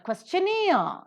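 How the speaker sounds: questionnaire with -naire high and drawn out; questionnaire, high long final syllable; stress on last syllable. Shown as a demonstration. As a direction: slowly lean on the end of questionnaire. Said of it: "na is accented here".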